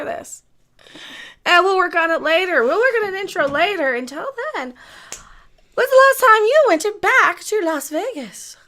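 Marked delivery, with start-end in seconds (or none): 5.7-8.6 higher, louder show-host question; presenter opener. Pretend. Host opening a segment on Las Vegas, projected presenter voice. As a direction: project it, drawing out the end.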